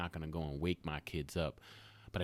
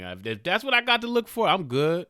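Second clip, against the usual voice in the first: daughter's voice; 10 semitones higher, 9 dB louder than usual. Retold or imitated. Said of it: "She's like".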